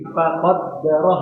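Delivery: Faqaddara with the damma pressed firmly, slow; stress on the damma. Shown as a demonstration. Teacher correcting the student's damma, pressed vowel. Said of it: "Gini, gini".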